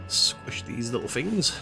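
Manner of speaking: ordinary talk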